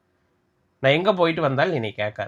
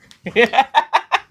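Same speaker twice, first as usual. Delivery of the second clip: loud high cackle at the prank; husband laughing at his wife's face in the prank; high burst of laughter; 10 semitones higher, 7 dB louder than usual. Real, not acted.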